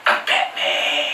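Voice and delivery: low, slow Batman rasp; Batman growl. Retold or imitated. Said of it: "gets right up in their face and goes".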